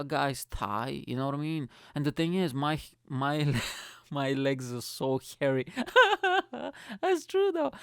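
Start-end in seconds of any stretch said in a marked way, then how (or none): 4.1-7.7 amused confession. Real, not acted. Cracking up at own admission.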